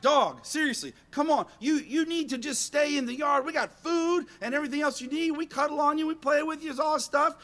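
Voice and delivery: high-pitched mock scolding; acted-out fed-up lecture; exasperated pleading. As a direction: plead with the dog, worked up. Acted scolding of his runaway dog.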